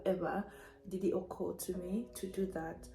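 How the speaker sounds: ordinary talk, nothing marked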